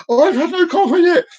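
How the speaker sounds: high-pitched mock complaint; acted caffeine-starved exasperation; grumpy protest. Acted grumpy person, repeated emphatically.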